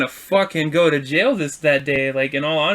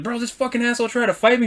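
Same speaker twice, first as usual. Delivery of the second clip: raised-pitch outraged complaint; retold indignant report; 7 semitones higher, faster than usual. Driver phoning a friend after the fight, retold indignantly. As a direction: act out venting, not shouted.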